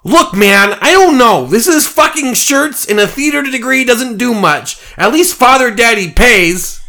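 very high, loud, whiny bunny voice; a fed-up actor in a bunny suit; exasperated bunny. Acted. Easter Bunny whining back at the drunk man, high and loud.

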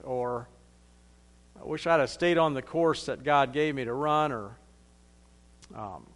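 soft, sinking voice of a man full of regret; a soft, dying man's regret, lightly voiced; regretful confession. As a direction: let it drop quiet, like a dying man's regret. Imagined regretful deathbed confession.

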